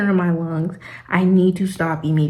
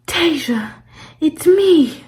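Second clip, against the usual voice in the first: desperate arrival; 8 semitones higher, much slower than usual. Retold or imitated. Arrives panting at check-in desk.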